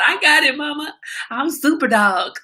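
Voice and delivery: high-pitched overconfident puppy; cocky dog voice. Retold or imitated. Owner mocking her idiot dog, high cocky puppy voice. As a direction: play an idiot dog boasting to Mama. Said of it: "the idiot dog talking about".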